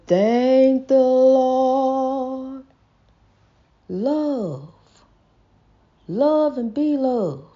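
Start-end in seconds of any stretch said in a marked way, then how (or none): none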